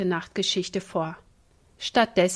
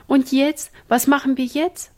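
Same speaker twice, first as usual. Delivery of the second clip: high, louder, helpless question; at a loss; 6 semitones higher, 5 dB louder than usual. Acted. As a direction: ask it in a panic, stumped. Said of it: "fragte Ina ratlos".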